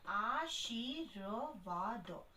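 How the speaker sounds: "आशीर्वाद" slowly sounded out in separate pieces: आ-शी-र-वा-द; slow teacher's breakdown of "आशीर्वाद" as आ, शी, र, वा, द. Shown as a demonstration. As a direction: spell out "आशीर्वाद" sound by sound, stretching each. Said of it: "तो ये बन गया".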